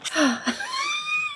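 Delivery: high squealing burst of laughter. Real, not acted. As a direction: squeal with delight. Mask peeling, delighted squeal.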